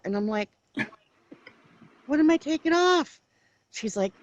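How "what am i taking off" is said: high, loud confused disbelief; genuinely lost, ask it up high; baffled question. Retold or imitated. Mom retells confusion at daughter.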